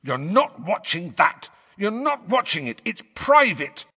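high, urgent protest; indignant inner protest. A narrator's reading. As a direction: forbid it fiercely, pitch climbing to the last word. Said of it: "said a voice inside Harry's head".

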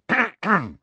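pointed, high, loud ahem; loud throat-clearing. A narrator's reading. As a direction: clear the throat to break a reverie. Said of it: "clearing his throat loudly".

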